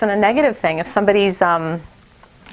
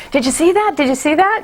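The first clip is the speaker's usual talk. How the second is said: put-on excited child; 7 semitones higher than usual. Child excited about his hit.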